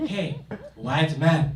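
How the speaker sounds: slow, drawn-out, condescending call; like to an idiot. Retold or imitated. Stranger in Ghana calling again, slow and condescending. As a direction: spell it out like he's talking to a fool. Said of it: "says it again like I'm an idiot".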